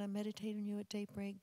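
ordinary talk

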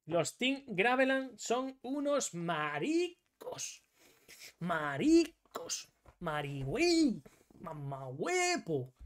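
very slow, exaggerated insults; hammed-up, drawn-out name-calling; mocking taunts. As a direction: stretch each word out with a big swoop. Mock insults at the opposing team.

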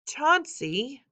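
high, drawn-out scolding call; mildly cross, rising reproach. A narrator's reading. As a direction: scold a little one by name. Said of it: "she scolds".

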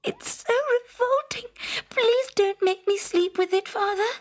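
high, crying plea to father; tearful pleading princess. A narrator's reading. Princess begging her father not to share her bed. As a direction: beg through tears.

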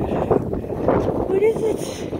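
baffled, slow, high-pitched question; drawn-out high question. Real, not acted. Approaching strange device.

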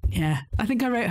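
ordinary talk, nothing marked

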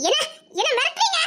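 high, loud frightened question; alarmed cry. Acted. Girl alarmed by his threat. (பயந்த உச்சக் குரல்)

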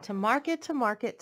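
put-on lilting read-aloud voice for little kids; storybook reading. Reading a picture book to young children, lilting storytelling. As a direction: read it like bedtime story time.

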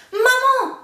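high, loud yell for mom; boy yelling. Acted. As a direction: shout for your mother as you land. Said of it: "hurla Jacques".

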